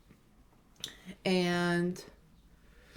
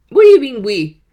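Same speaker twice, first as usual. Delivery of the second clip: giddy disbelief; 5 semitones higher, 15 dB louder than usual. Real, not acted. Giddy over his word we.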